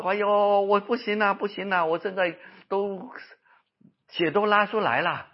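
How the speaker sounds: high-pitched, dragged-out moan of despair; distressed wailing. Retold or imitated. Preacher voices a relapsed cancer patient, distressed wail. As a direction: imitate a patient wailing in alarm.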